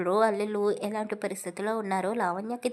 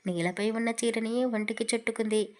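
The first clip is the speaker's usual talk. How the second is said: ordinary delivery, nothing marked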